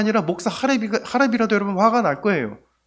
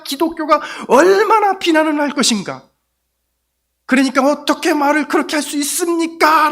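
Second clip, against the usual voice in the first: raised, higher, urgent voice; heated inner argument; 8 semitones higher, 5 dB louder than usual. Retold or imitated. Pastor arguing with a rude neighbour, raised and urgent. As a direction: let it rise with alarm.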